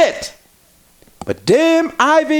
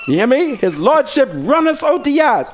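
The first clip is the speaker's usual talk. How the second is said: normal talking voice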